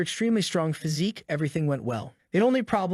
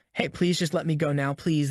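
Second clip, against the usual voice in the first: ordinary delivery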